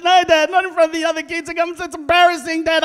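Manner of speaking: put-on high, loud pleading kid; embarrassed son. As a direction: protest to Dad like a mortified child.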